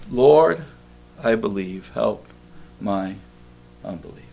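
a father pleading through tears, dropping to near silence; tearful plea. Retold or imitated. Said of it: "cried out and said with tears".

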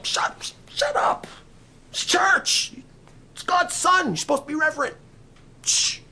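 high-pitched, indignant shushing; scolding hush. Acted. Crowd telling blind man to be reverent, scolding. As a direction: shush him like an outraged churchgoer.

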